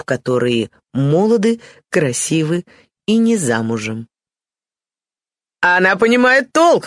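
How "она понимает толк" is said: high, lively outburst without actual laughter; amused exclamation. A narrator's reading. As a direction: exclaim it with hearty approval. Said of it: "воскликнул Генрих Лин, громко смеясь". (весёлое громкое восклицание)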